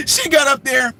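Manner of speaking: ordinary talk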